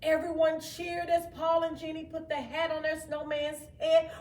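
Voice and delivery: ordinary delivery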